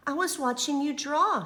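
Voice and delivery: a hint of a younger sister; little sister. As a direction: say it gently like a small sister. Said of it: "she said".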